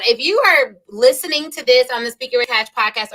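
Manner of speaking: usual speaking voice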